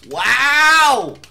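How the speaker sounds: high, loud astonished wow; real disbelief at the blunder; stunned loud exclamation. Real, not acted. Can't believe failed twice.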